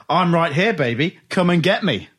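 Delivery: punchy mock tough-guy challenge; mock wrestler promo. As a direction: imitate a wrestler calling out a challenge. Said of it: "I'll do it word for word".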